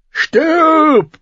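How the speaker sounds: savage high roaring growl. A narrator's reading. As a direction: snarl it with raw rage. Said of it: "knurrte der Mann".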